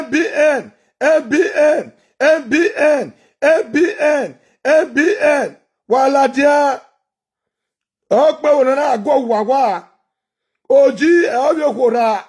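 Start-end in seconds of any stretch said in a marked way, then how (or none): none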